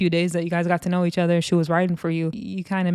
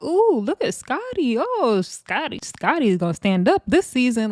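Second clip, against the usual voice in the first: teasing mock; 5 semitones higher than usual. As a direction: mimic a condescending tease. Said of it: "Natalie had said many times before".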